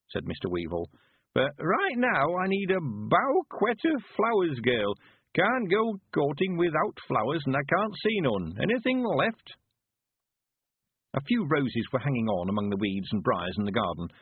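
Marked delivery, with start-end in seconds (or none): 1.3-9.6 put-on high, slow rustic old-timer; high, swooping old man's dialect voice; old country man, eager. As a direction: draw out the words as an excited old villager. Narrator plays old Mister Weevil.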